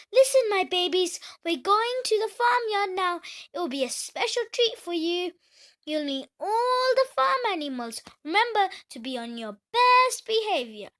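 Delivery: a mother duck calling her babies together. Acted. Mother duck addressing ducklings.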